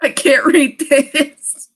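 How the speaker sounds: can barely get it out for laughing; struggling through laughter. Real, not acted. Reading the story to viewers while cracking up. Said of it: "I'm so stick and twisted".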